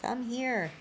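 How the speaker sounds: put-on high sing-song call to a child; parent calling child. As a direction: call your kid over in the store. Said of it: "a Spanish-speaking parent say to their child".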